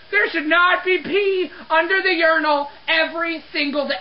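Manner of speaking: ordinary talk, nothing marked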